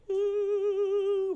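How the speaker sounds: very high breathy starstruck "ooh"; mock swooning ooh; starstruck gasp. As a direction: swoon like you just saw Jesus. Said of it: "just like".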